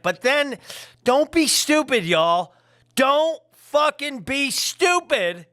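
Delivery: high, loud playful yell at the viewers; mock yelling. Real, not acted. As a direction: shout it out, half joking. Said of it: "and I'm yelling".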